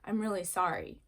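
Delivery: mean the apology, gently; sincere apology. Shown as a demonstration. Child making amends to a sibling, sincere. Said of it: "different meanings".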